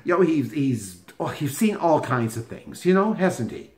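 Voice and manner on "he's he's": ordinary talk, nothing marked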